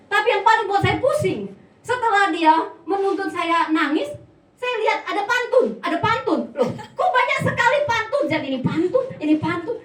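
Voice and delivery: high, loud, exasperated disbelief; puzzled and flustered, pitch jumping up; mock bewilderment. Preacher teases husband's notes full of pantun, mock bewilderment.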